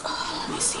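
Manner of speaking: whispered aside to herself; soft whisper while looking for something. Real, not acted. Muttering aside while searching.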